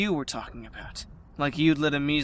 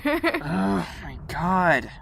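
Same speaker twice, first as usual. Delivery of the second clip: exasperated; 4 semitones higher, much slower than usual. Acted. Friend fed up with the Danger bet, exasperated.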